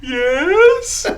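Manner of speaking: high, drawn-out questioning yes; caught-out reply. Retold or imitated. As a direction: answer in a high voice, stretching it out. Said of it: "all Ryan does is go ... Just like that".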